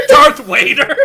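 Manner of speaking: laughing shout of the name. Real, not acted. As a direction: burst out laughing while shouting. Laughing while exclaiming.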